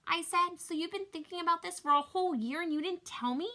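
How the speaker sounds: high, loud incredulous questioning; indignant disbelief. Acted. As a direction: protest in shocked disbelief. Child learns of parents' secret.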